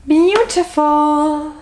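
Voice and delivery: slow, drawn-out admiring sigh of a word; gushing. Real, not acted. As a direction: swoon over the book. Admiring Lord of the Rings books.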